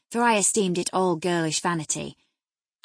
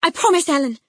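high, loud desperate promise; pleading cry; 11 semitones higher, 10 dB louder than usual. A narrator's reading. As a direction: cry it out to stop her. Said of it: "she cried".

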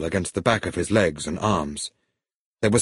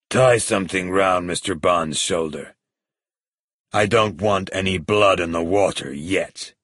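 lightly voiced crime boss, in control; a hint of a villain giving orders; menacing villain. Villain orders guard to bind his prisoner, menacing.

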